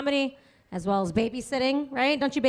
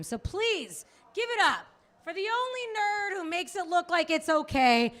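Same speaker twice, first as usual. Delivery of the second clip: higher, drawn-out announcer call on the name; host introduction; 6 semitones higher than usual. Real, not acted. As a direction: bring the next comic on stage. Host introducing next comic.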